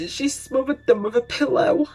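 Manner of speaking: high, strained-up retold confession; imitated emotional confession. Suspect telling dad how she hurt them, high emotional imitation. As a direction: pitch it high like an imitated outburst.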